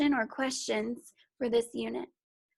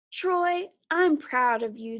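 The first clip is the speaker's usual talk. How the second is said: a cheer, higher and louder; 3 semitones higher, 5 dB louder, slower than usual. Acted. Joy cheering Troy for going out. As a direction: cheer it out proudly. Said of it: "Joy cheered".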